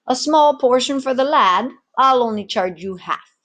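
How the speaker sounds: a barman striking a small deal; barkeep's offer. Acted. Barkeep offers the lad a half-price drink.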